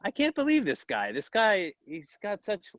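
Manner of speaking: high, amazed "can't believe this guy"; amazed disbelief. Retold or imitated. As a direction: marvel at him out loud. Imitating awed onlookers.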